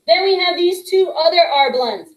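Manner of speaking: ordinary talk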